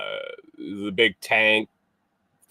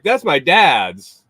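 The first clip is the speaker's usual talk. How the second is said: quoting the guy; 6 semitones higher, 7 dB louder, much slower than usual. Retold or imitated. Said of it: "he's like".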